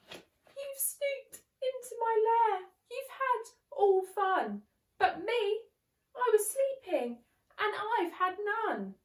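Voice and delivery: high, loud, crying complaint; hammed-up tearful bear; whimpering bear. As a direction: wail it like a sulking bear cub. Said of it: "He whimpers and he moans".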